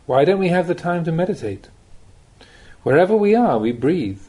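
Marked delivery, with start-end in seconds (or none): none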